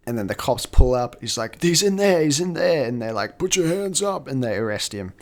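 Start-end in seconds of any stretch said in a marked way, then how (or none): 1.6-2.9 high-pitched urgent pointing-out; acted high, urgent alert; urgent retold cry. Voicing a man shouting to arriving cops. 3.4-4.3 high-pitched acted arrest call; retold cop command, pitched up; mock police order. Voicing cops making an arrest.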